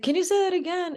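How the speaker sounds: retold bewildered plea to repeat it; insistent repeat request. Worker still unable to understand customer, high and bewildered.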